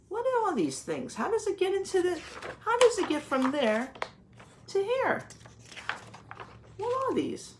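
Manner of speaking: exaggerated curious questions. Shown as a demonstration. Teacher quizzing kids, pitch up.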